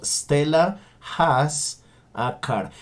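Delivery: slow, careful English for learners; slow model sentence. Shown as a demonstration. Teacher slowly models Stella has a car. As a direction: spell it out slowly. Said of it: "Entonces decimos".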